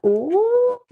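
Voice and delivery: high, loud squeal of delight; thrilled high ooh. Real, not acted. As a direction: squeal at how it looks. Delighted exclamation.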